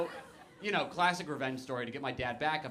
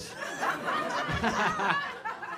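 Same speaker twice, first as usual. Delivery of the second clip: breathy high laugh; 7 semitones higher than usual. Real, not acted. Laughing at story.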